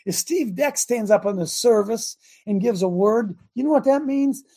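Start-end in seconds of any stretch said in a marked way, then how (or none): none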